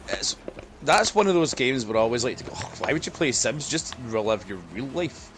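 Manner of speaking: ordinary delivery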